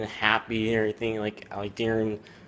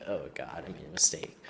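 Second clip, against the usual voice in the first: low, hushed regret sinking into a whisper; retold regret; 6 semitones lower, 6 dB quieter than usual. Boy realizing he made a mistake, hushed regret. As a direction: drop low and soft, like a private admission.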